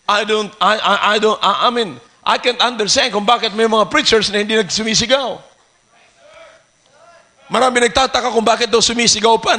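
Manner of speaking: normal talking voice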